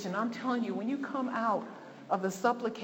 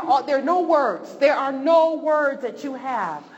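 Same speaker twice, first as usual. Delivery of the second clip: impassioned preaching; 4 semitones higher, 7 dB louder than usual. Acted. Impassioned preaching about grief.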